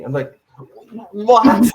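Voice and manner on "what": shocked what. Retold or imitated. Retold shocked reaction to dad.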